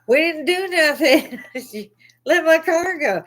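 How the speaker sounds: high, laughing mock protest. To the police about the impounded car, laughing mock protest. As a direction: giggle through a fake outraged plea.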